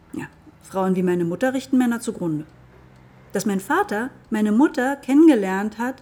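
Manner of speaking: ordinary talk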